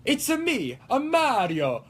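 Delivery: high cartoon Italian plumber, easing downward; high sing-song game mascot catchphrase; Mario. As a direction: start squeaky and slide down in an Italian lilt. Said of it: "When you're trying to find the accent".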